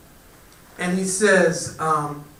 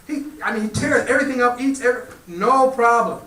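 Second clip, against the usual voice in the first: alarmed warning; 7 semitones higher, 3 dB louder than usual. A narrator's reading. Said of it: "she said".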